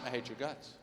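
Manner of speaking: low, soft, deadpan venom; cold wife. Acted. Wife answering her starving husband, quietly hateful. Wife's reply in acted row.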